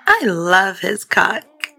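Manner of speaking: put-on slow, high, gurgly girl voice; gurgling. A narrator's reading. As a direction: gurgle it with relish. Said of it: "Judy gurgled".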